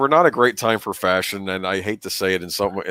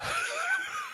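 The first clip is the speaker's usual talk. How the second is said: high laugh; 12 dB quieter than usual. Real, not acted. Watching a sad scene.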